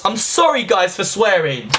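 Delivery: usual speaking voice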